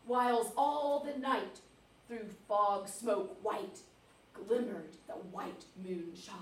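slow, hushed verse reading; measured, atmospheric ballad telling; poem recitation. Acted. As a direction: recite softly, lingering on each phrase. Reads poem aloud.

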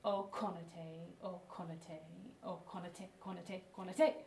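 konate chanted low with a punched K; low rhythmic chant, leaning on the K of konate; emphasis on K. Shown as a demonstration. Teacher demonstrating the movement, low chant stressing K in konate. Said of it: "the emphasis is on k".

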